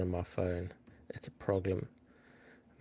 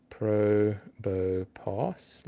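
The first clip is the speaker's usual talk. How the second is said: Probopass drawn out very slowly, syllable by syllable; sounding it out; much slower than usual. Shown as a demonstration. Streamer checking a spelling on a phone, sounding it out slowly. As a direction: stretch each part of Probopass, rising at the end. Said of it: "like that".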